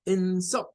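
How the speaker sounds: "insult" as IN-sult, high first syllable; stress on first syllable. Shown as a demonstration. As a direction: noun "insult", force on "in-". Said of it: "es la primera".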